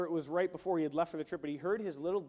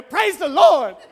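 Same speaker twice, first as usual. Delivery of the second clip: much higher and louder, a burst of praise; joyful shout; 10 semitones higher, 18 dB louder, slower than usual. Pretend. Preacher imitating a pastor's washroom joy, praise shouted. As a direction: shout it out like you just got saved. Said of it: "I go".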